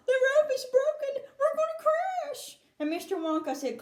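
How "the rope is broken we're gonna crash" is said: high, shrieking alarm; terrified of falling; high frightened scream; panicked cry. Acted. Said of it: "Missus T V cried out".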